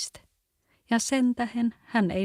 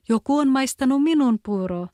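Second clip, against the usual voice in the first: higher, louder little bear cub; 6 semitones higher, 7 dB louder than usual. A narrator's reading. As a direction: squeak it out as the tiny bear. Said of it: "hänen pikkuäänellä". (pienen karhunpennun korkea ääni)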